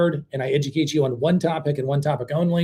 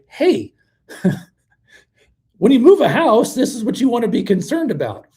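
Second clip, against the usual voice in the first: high, louder, chipper salesman voice with a laugh; mock salesperson pitch; 7 semitones higher, 4 dB louder than usual. Host mocking sales staff, bright high salesman voice. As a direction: play the cheery dealer who finally explains things. What salespeople should say instead.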